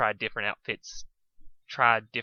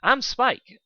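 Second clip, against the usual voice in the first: loud, much higher eager intro; eager dragon introducing himself; enthusiastic; 13 semitones higher, 7 dB louder than usual. Acted. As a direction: bounce in with it. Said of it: "said the little dragon enthusiastic".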